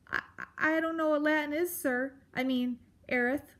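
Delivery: high, hurried little mouse; nervous stammer. Acted. Mouse admits ignorance to the grump, nervously. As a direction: fluster through it, then correct yourself meekly.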